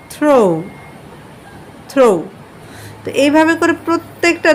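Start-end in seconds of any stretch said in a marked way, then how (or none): 0.1-2.2 থ্রো said crisply twice, falling; modelling pronunciation. Shown as a demonstration. Teacher modelling the word throw for students to copy. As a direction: repeat থ্রো clearly for the class. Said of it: "আবার এটা বলছি কি থ্রো".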